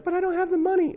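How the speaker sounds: high, loud mock whine; hammed-up whiny teen excuse. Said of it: "You're like".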